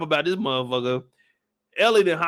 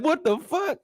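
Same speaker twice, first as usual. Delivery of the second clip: shocked quote; 10 semitones higher than usual. Retold or imitated. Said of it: "I'm like".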